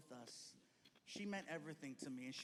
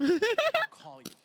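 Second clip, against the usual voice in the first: high, slow sing-song; high drawn-out promise; 21 dB louder, much slower than usual. Retold or imitated. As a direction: stretch it out, teasing. Quoting himself, ending the call.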